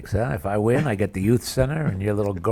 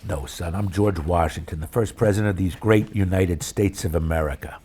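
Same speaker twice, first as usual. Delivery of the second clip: George Washington; 5 semitones lower, 5 dB quieter than usual. Acted. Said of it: "playing the role of George".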